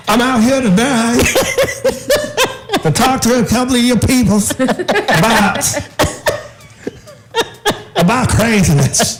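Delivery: high, laughing street preacher impression; State Street preacher. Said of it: "You sound like the State Street preacher".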